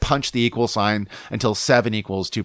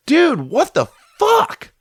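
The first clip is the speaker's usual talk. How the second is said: incredulous, high-pitched outburst with the last word drawn out; yelling, pissed; 3 dB louder, much slower than usual. Retold or imitated. Surfer confronting the guy who cut in, yelling pissed. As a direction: re-enact outraged disbelief, slow and high. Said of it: "I'm yelling at the guy".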